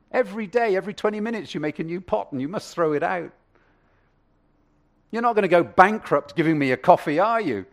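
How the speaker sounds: high, loud wheedling argument; arguing customer. Acted. Customer argues with Rachel.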